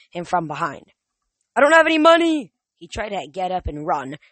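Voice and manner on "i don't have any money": put-on high, loud panicked old man; panicked shout. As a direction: cry out in panic.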